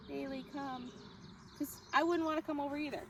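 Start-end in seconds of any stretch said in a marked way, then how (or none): 0.1-0.9 flat, bored call of the dog's name; dull recall. Shown as a demonstration. Trainer showing the wrong, boring way to call. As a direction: say it like you couldn't care less. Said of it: "I don't want you to be like".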